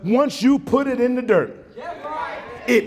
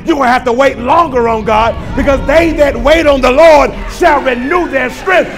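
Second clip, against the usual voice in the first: high, loud shouted preaching; preaching at full force; 5 semitones higher, 5 dB louder than usual. Real, not acted. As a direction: build it up to a shout for the church. Preaching climax.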